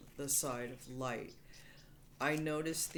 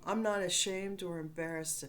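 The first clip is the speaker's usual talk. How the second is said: normal talking voice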